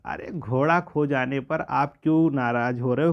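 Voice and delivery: ordinary talk, nothing marked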